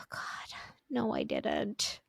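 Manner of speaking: whispered, hushed denial. Retold or imitated. Mom whispering her denial to her daughter in the dark. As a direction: breathe it out in a spooked whisper. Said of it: "And I was like".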